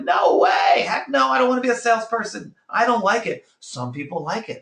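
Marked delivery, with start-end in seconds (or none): 0.0-3.5 a flat-out, hammed-up no way; recruit refusing. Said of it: "They're gonna be like".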